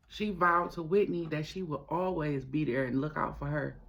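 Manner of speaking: ordinary delivery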